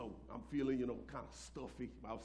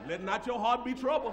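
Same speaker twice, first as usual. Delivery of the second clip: full-force preaching; 7 semitones higher, 13 dB louder than usual. Acted. Pastor shouting his faith to the congregation, full-force preaching. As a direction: preach it to the rafters.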